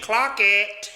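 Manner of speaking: mock quote. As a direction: act out the slang to ridicule it. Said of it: "think I'm out here saying".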